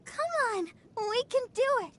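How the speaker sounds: high, peppy rallying cheer. Acted. Cheering herself and team on.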